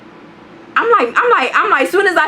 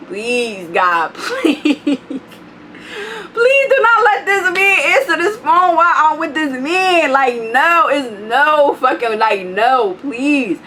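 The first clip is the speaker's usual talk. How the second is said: higher, half-laughing whiny plea; mock pleading; 5 semitones higher, slower than usual. Narrator praying the man won't answer the phone, mock whine. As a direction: beg God in comic despair. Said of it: "I'm like".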